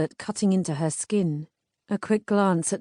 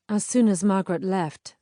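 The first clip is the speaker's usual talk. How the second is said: usual speaking voice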